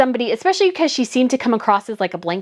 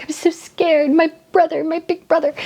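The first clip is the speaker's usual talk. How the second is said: higher, mock-whimpering little brother; whiny wimp; 6 semitones higher than usual. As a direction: play a wimpy kid hiding behind his brother. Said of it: "whiny and wimpy".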